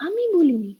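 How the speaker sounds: "আমি" high and loud; stress on আমি. Shown as a demonstration. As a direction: punch "আমি" to put the emphasis on oneself. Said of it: "you are telling that আমি".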